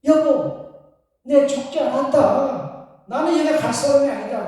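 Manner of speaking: acted urgent protest from grandpa; grandfather protesting. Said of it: "할아버지가 손으로".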